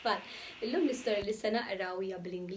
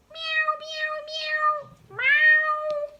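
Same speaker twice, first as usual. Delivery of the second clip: very high, loud meowing; kitten meows; 12 dB louder, much slower than usual. Acted. The kittens meow at Spot. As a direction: mew like tiny kittens.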